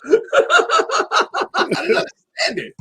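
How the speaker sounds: laughing through the words; words breaking into laughter. Real, not acted. Laughing at the show.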